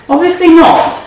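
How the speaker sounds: high, dry self-deprecating aside; mock-exasperated at herself; wry self-mockery. Real, not acted. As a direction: laugh at your own mistake. Realizing she forgot the dishwasher.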